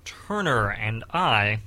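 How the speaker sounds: put-on old-time radio host voice; radio announcer.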